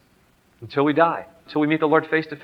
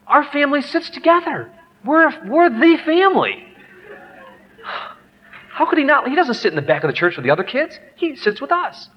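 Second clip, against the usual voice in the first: high-pitched mock-hurt protest; indignant father; 10 semitones higher than usual. Dad insisting his son sit with the family, high and indignant. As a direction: wail the protest in a high, put-on voice.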